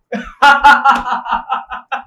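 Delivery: long, loud burst of laughter; loud mocking laugh. Man ridiculing the admirer's words, laughing loudly. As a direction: laugh heartily at the gushing praise. Mocks the quoted praise.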